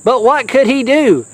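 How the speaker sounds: ordinary delivery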